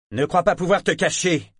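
ordre tonné, plus aigu et plus fort; voix tonnante. Acted. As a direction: tonne la menace. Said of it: "tonna Saadar". (voix tonnante)

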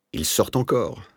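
high-pitched agitated report; great agitation. A narrator's reading. Old asthmatic tells the doctor of rats returning, greatly agitated. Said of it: "tous les signes d'une grande agitation".

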